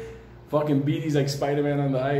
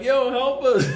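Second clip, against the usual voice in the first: put-on kids calling for help; 10 semitones higher, 5 dB louder, slower than usual. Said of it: "And we're like".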